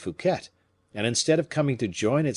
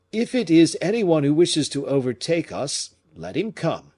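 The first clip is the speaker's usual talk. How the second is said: proud, lofty, higher at the start; looking down on the chase, lofty and cool; haughty; 7 semitones higher, 6 dB louder than usual. Acted. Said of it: "said with much hauteur".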